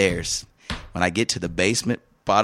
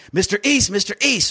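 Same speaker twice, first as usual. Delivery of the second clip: calling out; 11 semitones higher than usual. Retold or imitated. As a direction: hail him from upstairs. City worker calling him up.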